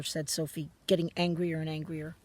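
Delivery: usual speaking voice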